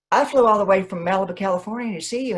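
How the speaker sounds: high, rushed fan babble; retold starstruck gushing, fast and high. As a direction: blurt it out like an overeager fan. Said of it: "So I was an idiot".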